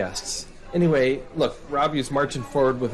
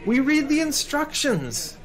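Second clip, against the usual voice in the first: building rant; 10 semitones higher than usual. Real, not acted. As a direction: work yourself into a lecture that keeps rising. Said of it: "don't blow a gasket".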